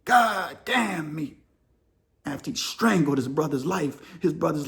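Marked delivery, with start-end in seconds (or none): 0.1-1.4 slow, breaking remorse; anguished regret. Retold or imitated. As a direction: drag it out as if near tears. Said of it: "And he's like".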